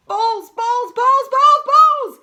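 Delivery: high, loud chanting shouts; crazed repetition. A narrator's reading. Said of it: "muttering to himself over and over".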